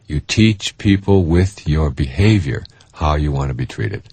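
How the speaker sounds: ordinary talk, nothing marked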